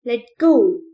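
high, loud, straining command; forceful shouted order; yelled. A narrator's reading. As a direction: yell it while tugging hard. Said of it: "Newt yelled as he tugged".

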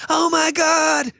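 high, stretched mock scream; hammed-up outburst, way up in pitch; mock freak-out. Client hitting thirty seconds of the ordeal, mock freak-out.

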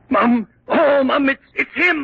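gasp, then cry out in terror; panicked shout of fright; terrified gasp and cry. Acted. Servant sees the beast.

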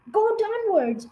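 high-pitched, brisk command; urgent order. A narrator's reading. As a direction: direct the family with urgency. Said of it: "ordered Mister Fox".

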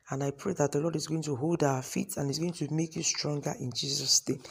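quiet prayer; hushed closing prayer. Acted. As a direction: softly pray a blessing over them.

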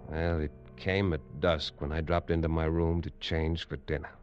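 ordinary talk, nothing marked

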